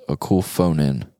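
usual speaking voice

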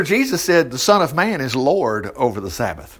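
ordinary talk, nothing marked

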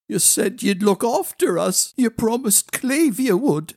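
high-pitched accusing protest; pleading child. A narrator's reading. Child holding the prince to a promise, pleading. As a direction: plead like a let-down youngster.

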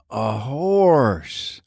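put-on small boy repeating in wonder; little boy. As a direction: say it like a curious little kid. Little boy repeats after dad.

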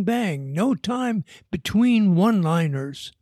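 ordinary delivery

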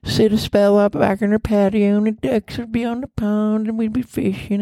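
put-on high, thin old southern granny; lightly quavering granny voice. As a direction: play a sweet old grandma reminiscing. Granny character voice, far, high.

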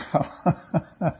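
short chuckle at own fate; rueful laugh. Real, not acted. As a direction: laugh it off wryly. Laughs at own bad luck.

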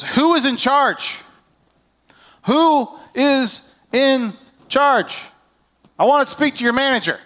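high, slow indignant demand; mock demand. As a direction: play the entitled customer asking for the manager.

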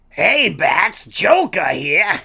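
a hint of a Joker teasing Batman; Joker impression. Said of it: "I gotta work on it".

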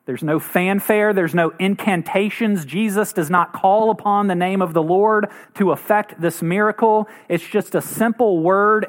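normal talking voice

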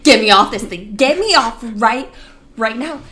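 frantic plea to get off; panicked demand. Retold or imitated. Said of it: "and I'm like".

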